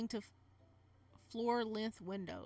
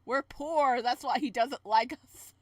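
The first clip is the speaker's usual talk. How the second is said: mock outburst; 2 semitones higher, 10 dB louder, slower than usual. Real, not acted. Loud joke about the uncle.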